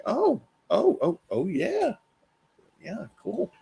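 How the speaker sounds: mock awkward reaction. Retelling the crowd hearing the singer swear, mock awkward. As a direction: play the stunned fan caught off guard.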